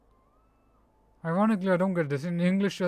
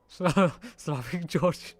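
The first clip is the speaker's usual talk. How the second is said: laughing. Real, not acted. Laughing while reading chat.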